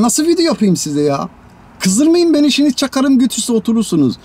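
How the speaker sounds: loud, high, exasperated shouting rant; angry rant. Acted. A handyman fed up with chores, ranting loudly at viewers. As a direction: yell at the viewers, fed up with the work.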